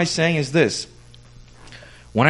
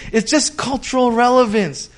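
higher, dismissive brush-off; mock excuse; 9 semitones higher than usual. Pastor mimics a believer's lazy excuse, pitched high. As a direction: toss it off like a lame excuse. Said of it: "And you say".

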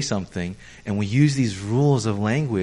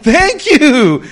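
much higher and louder "thank you"; naive thanks; 12 semitones higher, 10 dB louder than usual. Retold or imitated. Pastor plays his naive self, loud and high. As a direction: take it as a compliment, beaming.

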